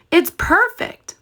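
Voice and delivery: very high, chirpy piglet voice; perky piglet. Acted. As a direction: toss it off way up high. Boris brushing off Percy's doubt.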